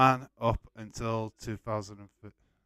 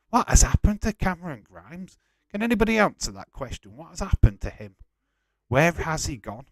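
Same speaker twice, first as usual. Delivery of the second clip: higher, louder demanding questions; exasperated outcry; 7 semitones higher, 7 dB louder than usual. Real, not acted. Fan ranting about a vanished wrestler, exasperated. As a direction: demand answers, really worked up.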